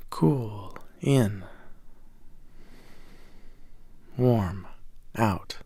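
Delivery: soft slow whisper, pacing the breath; whispered breath cues. Acted. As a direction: breathe the words out gently. Breath cues during meditation.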